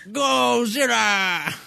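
high, drawn-out cry in fake Japanese accent; slow, exaggerated Japanese accent call; mock Japanese accent. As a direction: stretch it out in a hammed-up Japanese accent. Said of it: "really racist-like".

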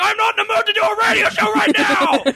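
high, hammed-up complaint; exasperated outburst, played for laughs; mock exasperated. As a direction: whine it high in comic frustration. Mock outburst at drive-thru guy.

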